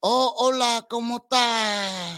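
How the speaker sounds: drawn-out mock Vietnamese-accented Spanish; slow, put-on Vietnamese accent on Spanish. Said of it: "It probably would sound kinda like that".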